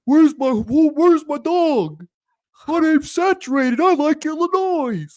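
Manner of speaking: high, put-on silly voice; Saturated impression. Co-host demands the viewer impression, done in a high goofy voice. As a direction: pitch it way up like a goofy fan. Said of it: "Come on, let's hear it".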